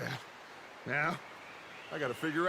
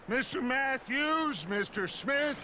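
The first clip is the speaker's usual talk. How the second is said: calling out; 8 semitones higher than usual. Acted. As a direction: call each man by name over the distance. Calling out orders across camp.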